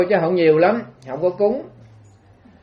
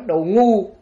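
angry insult; 3 semitones higher, 4 dB louder than usual. Retold or imitated. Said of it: "Con tức".

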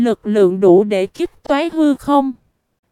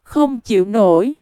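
plain reading voice

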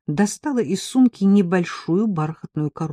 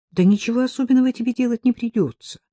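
higher, insistent coaxing; persuading; 8 semitones higher, 4 dB louder, faster than usual. A narrator's reading. Ella talks her reluctant friend into an errand, persuading. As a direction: talk her into it. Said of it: "убеждала меня Элла".

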